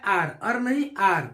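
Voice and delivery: "आर" open aa sound, contrasted with "अर"; correcting ur to ar; ar not ur. Shown as a demonstration. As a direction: stress "आर", reject "अर". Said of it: "उच्चारण आर होता है".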